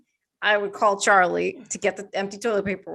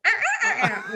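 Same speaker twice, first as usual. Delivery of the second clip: cat answering; 11 semitones higher, much faster than usual. Retold or imitated. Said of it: "and he'll go".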